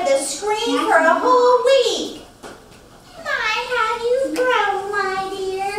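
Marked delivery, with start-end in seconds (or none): 3.2-5.7 higher doting aunt voice; aunt cooing over a child; Aunt Rita voice. Retold or imitated. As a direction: coo it like a fond aunt. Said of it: "I visited my Aunt Rita".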